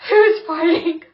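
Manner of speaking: high, loud, baffled question; bewildered. Retold or imitated. Deafened friend in the club, loud baffled shout. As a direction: shout it like you can't hear a thing. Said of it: "And I'm like".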